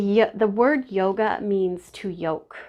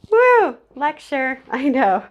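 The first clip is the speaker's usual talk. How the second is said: excited whoop; 5 semitones higher, 7 dB louder, slower than usual. Real, not acted. Snapping out of the emotion.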